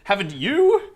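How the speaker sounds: hammed-up English gent talking baseball; British accent. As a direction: play a Brit faking an American fan. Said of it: "Americans who have British accents".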